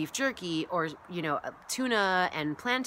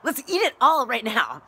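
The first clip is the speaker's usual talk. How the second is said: louder, higher greedy uterus voice; voice of her uterus; 5 semitones higher, 8 dB louder than usual. Pretend. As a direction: let your hungry uterus demand it. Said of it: "It was my uterus talking".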